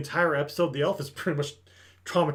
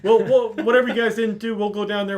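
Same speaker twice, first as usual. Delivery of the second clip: loud, higher imitated offer to help; mock rescuers stepping in, raised voice; hearty takeover; 6 semitones higher, 7 dB louder than usual. Reinforcements telling the party they'll take over, loud imitated eagerness.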